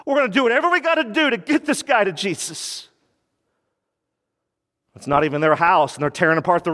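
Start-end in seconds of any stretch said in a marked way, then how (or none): 0.1-2.9 rising, fired-up resolve; determined friends. Retold or imitated. Four friends resolving to carry the man, fired-up. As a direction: push it higher, we will get him there. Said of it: "This is what they said".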